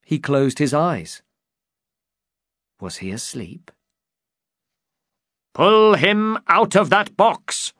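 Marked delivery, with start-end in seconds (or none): 2.8-3.7 hushed question. A narrator's reading. 5.5-7.7 high, loud, slow shout; angry shout. Acted. Knight shouts at the driver, high and loud.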